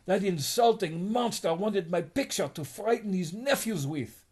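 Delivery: high, fuming complaint; indignant. Acted. Insulted lady complaining about the picture, high and indignant. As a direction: spit out the outrage.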